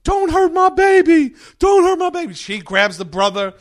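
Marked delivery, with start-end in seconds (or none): 0.0-2.3 very high, loud shrieking plea; pregnant woman screaming. Retold or imitated. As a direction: shriek to protect your unborn baby. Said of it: "the baby mama who's pregnant".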